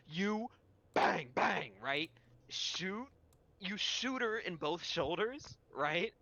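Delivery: ordinary talk, nothing marked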